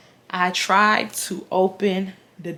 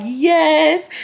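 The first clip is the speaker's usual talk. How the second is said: imitating near-tears answer; 7 semitones higher, 4 dB louder than usual. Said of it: "I'm starting to cry".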